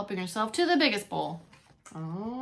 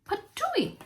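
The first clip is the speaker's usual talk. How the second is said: spitting out porridge; 12 semitones higher than usual. Acted. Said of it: "cried big old Papa Bear".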